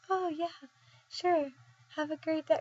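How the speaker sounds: high, soft, compliant voice; small, high voice of someone under a spell; persuaded clerk. Acted. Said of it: "And then be like".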